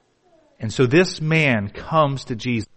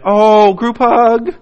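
mock sweet gushing; 8 semitones higher, 4 dB louder, much slower than usual. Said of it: "this is the way most people think".

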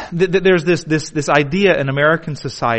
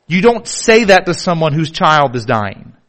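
usual speaking voice

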